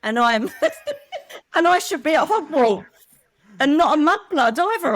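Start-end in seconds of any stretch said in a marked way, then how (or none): 0.5-1.5 a high, giggling laugh; high burst of laughter. Real, not acted. Laughing at her joke about the muggles. 1.6-4.9 said through laughter, higher and louder; laughing. Real, not acted. Joking to the host she belongs at Hogwarts, laughing.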